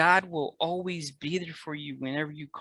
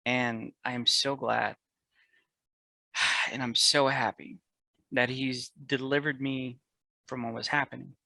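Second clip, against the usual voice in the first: ordinary delivery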